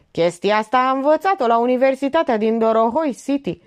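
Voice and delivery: high, lively joking comment; good-humoured. A narrator's reading. Titel jokes about where she learned it, good-humoured. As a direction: crack the joke in high spirits. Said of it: "Titel comentă bine dispus".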